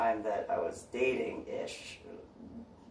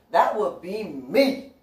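slow, high, pointed claim of ownership; slow loud pointed that would be me; pointed reply; 8 semitones higher, 5 dB louder, slower than usual. Retold or imitated. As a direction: draw it out, higher, loud and pointed. Answering the stranger.

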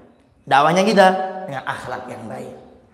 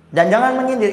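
high, loud jab of a warning; sharp warning; 9 semitones higher, 5 dB louder than usual. Real, not acted. Preacher warning wives, high and loud. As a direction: cut in sharply, no sarcasm. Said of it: "itu aja".

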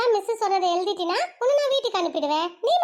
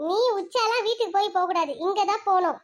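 ordinary delivery

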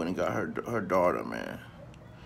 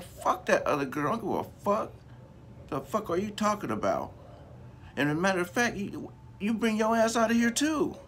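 mom's furious rant; 11 semitones higher than usual. Retold or imitated. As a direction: blast her like a furious mother kicking in the door. Mom raging at the girl.

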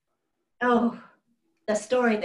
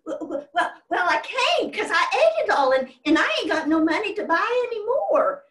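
higher, louder, panicky stammer; flustered Taddy stammering to his father, higher and louder; stuttering child; 9 semitones higher, 5 dB louder than usual. Retold or imitated. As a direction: trip over the words like a cornered little boy. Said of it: "Taddy started stuttering and carrying on".

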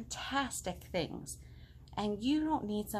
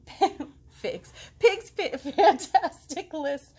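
laughing; 7 semitones higher, 9 dB louder, slower than usual. Real, not acted. Reader laughs at misreading.

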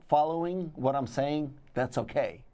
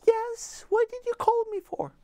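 much higher, sweet put-on partner voice; honey's voice; 3 dB louder than usual. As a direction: answer in a high falsetto as the partner. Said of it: "honey would be like".

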